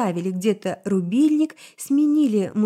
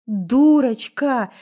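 usual speaking voice

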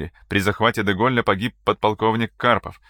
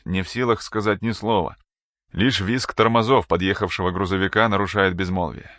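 ordinary delivery

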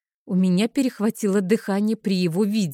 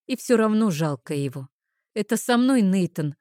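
ordinary delivery

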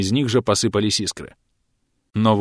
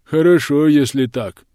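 ordinary delivery

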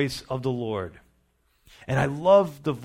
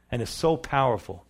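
ordinary talk

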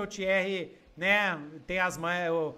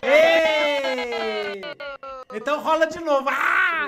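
loud excited yell at the table; excited shout; 7 semitones higher, 7 dB louder, much slower than usual. Real, not acted. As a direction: shout it out, pitch high. GM shouting at a player's roll.

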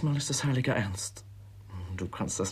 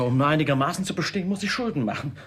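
louder, fast outburst; heated complaint; 5 semitones higher, 5 dB louder, faster than usual. Acted. Son vents about his miserly father, fast and loud. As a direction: blurt it out in frustration.

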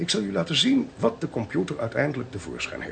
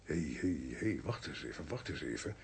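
much softer and lower, as a thought dawns; zacht hardop nadenkend; 9 semitones lower, 15 dB quieter than usual. Acted. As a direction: halt the talk softly, thinking it through. Valt plots iets in, denkt hardop. (zacht en laag, peinzend)

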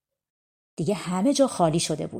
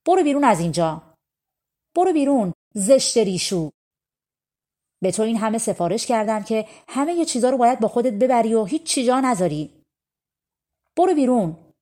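loud, higher furious command; angry scolding; 4 semitones higher, 4 dB louder than usual. Acted. Grandfather in the stove angrily ordering the girl out. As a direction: drive her out of the house.

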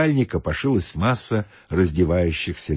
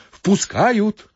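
high, quick announcing call; hurried shout; 13 semitones higher, 3 dB louder than usual. Acted. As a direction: call out that the door is open. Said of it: "торопливо крикнул кто-то".

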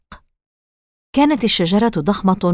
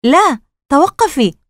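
high, drawn-out cry to stop; alarmed shout; 7 semitones higher, 4 dB louder, slower than usual. Acted. Casey pulling his sister back, alarmed shout. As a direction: cry out to halt her, slowly and high. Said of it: "صرخ كيسي".

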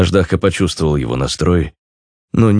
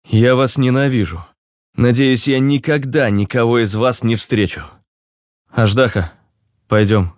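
bitter outburst; 6 semitones higher than usual. A narrator's reading. Ruslan rages at his companions.

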